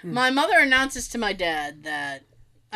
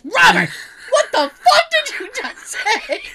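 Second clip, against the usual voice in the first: high, louder, slow, laughing demand; demanding outrage; 12 semitones higher, 6 dB louder than usual. Retold or imitated. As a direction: call out Robert in mock outrage. Mother spins and demands.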